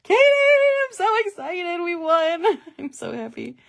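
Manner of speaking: very high, loud, drawn-out overexcited cheer; golden retriever quarterback. Acted. Golden retriever quarterback gushing that they won, hammed-up excitement. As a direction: gush like an overjoyed puppy of a player, easing off at the end. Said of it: "like, a golden retriever quarterback".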